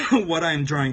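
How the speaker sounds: ordinary talk, nothing marked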